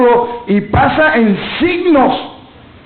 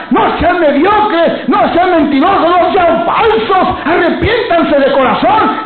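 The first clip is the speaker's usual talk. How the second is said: fiery preaching; 4 semitones higher than usual. Acted. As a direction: hammer each "no sean" at the congregation. Preacher at full force. (predicación con fuerza creciente)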